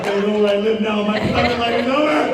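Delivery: loud, high hammed-up panic; mock panic. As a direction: wail like your secret is out. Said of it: "Scott was".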